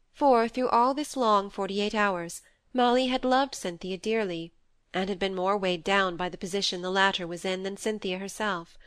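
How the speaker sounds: plain reading voice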